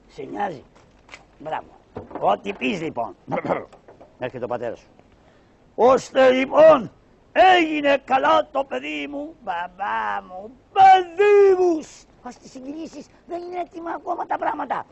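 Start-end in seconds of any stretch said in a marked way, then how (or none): 5.7-14.8 high, loud joyful pasha voice breaking into a cry; a father shouting with joy that his child is well; overjoyed pasha. Acted.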